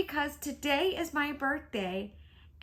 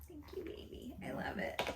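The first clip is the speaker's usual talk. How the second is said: soft, breathy whisper of gratitude; tender whisper to a loved one; whispered thanks; 3 semitones higher, 15 dB quieter than usual. Real, not acted. Wife whispers thanks to her husband, tender and hushed.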